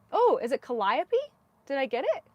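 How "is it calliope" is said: Calliope as kuh-LIE-uh-pee, asked with dawning realization; accent on LIE. Real, not acted. Knitter asking if she got it, Calliope as kuh-LIE-uh-pee. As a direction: ask it with Calliope stressed on LIE. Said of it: "The accent is on the lie".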